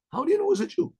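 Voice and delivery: high, incredulous repeat of a question; baffled questioning. Retold or imitated. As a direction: voice shoots up in puzzlement. General puzzled, questioning the rabbi.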